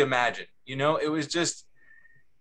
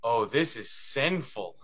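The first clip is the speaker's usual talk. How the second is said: savoring quote; 7 semitones lower, 4 dB quieter, slower than usual. Retold or imitated. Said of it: "he looks up and he goes".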